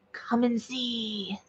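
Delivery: slow, hushed alien voice; eerie whisper-soft quote. Retold or imitated. Reading the beings' words, slow and hushed. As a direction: drop to a hushed, slow murmur.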